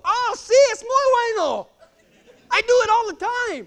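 high, loud comic assurance; eager insisting. Retold or imitated. Trainer to dismayed rider, high cheerful insistence. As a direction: breezily insist in a lifted voice.

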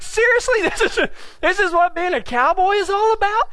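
high-pitched incredulous question; mock disbelief. Rider reacting to partner's advice, mock disbelief. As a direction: act out being appalled by the advice.

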